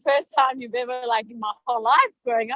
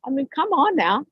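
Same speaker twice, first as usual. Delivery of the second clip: usual speaking voice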